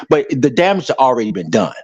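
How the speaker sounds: ordinary talk, nothing marked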